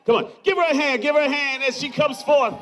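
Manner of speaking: rally the crowd to clap; crowd hype shout. Real, not acted.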